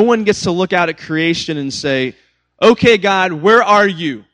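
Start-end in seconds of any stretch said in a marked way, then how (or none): 2.6-4.2 louder, higher demand to God; mock doubter calling God out; challenging.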